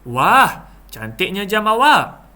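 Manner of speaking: loud, high exclamation of awe; impressed, drawn-out wow; admiring wonder. Shown as a demonstration. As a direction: gush in admiration. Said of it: "menggambarkan perasaan kagum".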